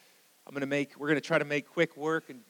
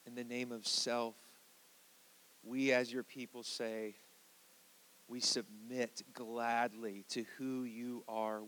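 hushed prayer; 3 semitones lower, 5 dB quieter than usual. Real, not acted. Pastor praying to the Holy Spirit before teaching, hushed prayer. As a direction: pray gently, with long pauses between phrases.

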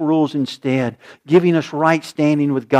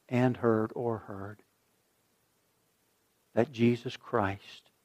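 soft, slow prayer with long pauses; slow hushed prayer; 4 semitones lower, 7 dB quieter than usual. Acted. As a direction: lead the room gently in prayer. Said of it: "I'm gonna say the words".

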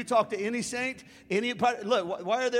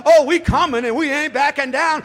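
loud, high bold boast; fired-up coach yelling the team's vow; shouted quote of the coach; 6 semitones higher, 13 dB louder than usual. Retold or imitated. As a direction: shout his defiance like a rally cry. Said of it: "has the nerve to step up".

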